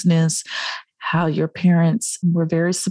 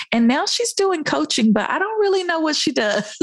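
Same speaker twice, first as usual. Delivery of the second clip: mock bewildered relative, laughing; imitating puzzled mom; 9 semitones higher than usual. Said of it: "they're like".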